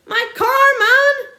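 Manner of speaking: very high, loud desperate shout. A narrator's reading. Landowner shouting in despair over his car. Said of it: "shouted Mister Hazel".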